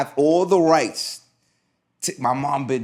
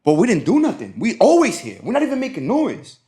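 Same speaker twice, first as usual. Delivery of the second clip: retold friends' protest; 7 semitones higher than usual. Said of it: "She's like".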